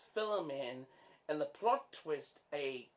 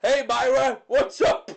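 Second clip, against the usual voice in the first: loud high cartoonish hey; a silly stretched-out character calling out; hammed-up higher, louder greeting; goofy greeting; 8 semitones higher, 14 dB louder than usual. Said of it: "just walking like that going".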